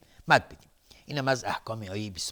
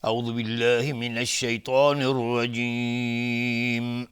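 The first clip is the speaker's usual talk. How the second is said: slow melodic tajwid chant; Quran recitation; 3 semitones higher, much slower than usual. Acted. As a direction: recite it slowly, drawing out the vowels. (تلاوت آهنگین قرآن)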